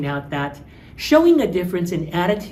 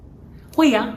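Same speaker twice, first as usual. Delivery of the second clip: higher, louder 会呀 with a fall; indignant of course; 8 semitones higher, 4 dB louder, slower than usual. Shown as a demonstration. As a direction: insist you obviously can. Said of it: "Of course I do".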